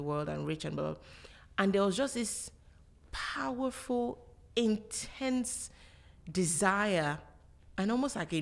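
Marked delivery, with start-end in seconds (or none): 3.1-5.7 "powerful, intense" drawn out slow and higher; weighted emphasis. Real, not acted. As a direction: dwell on "powerful," then "intense," each set apart. Dwelling on a strong feeling.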